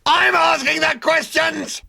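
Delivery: howling fury. A narrator's reading. Number two howls at Ford over the biscuits. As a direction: howl it, quaking with rage. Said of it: "howled number two".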